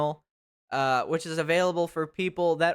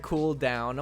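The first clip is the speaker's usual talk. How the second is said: usual speaking voice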